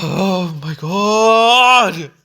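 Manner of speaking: exasperated oh my God. Real, not acted. Reviewer fed up with the sequel, dragging out an exasperated oh my God. Fed up after ranting about villains.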